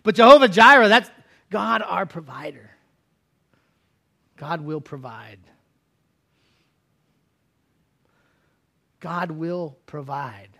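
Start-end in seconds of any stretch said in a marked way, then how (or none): 4.4-10.5 slow reassurance. Real, not acted. Pastor assuring the congregation, slow and soft. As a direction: let it settle gently.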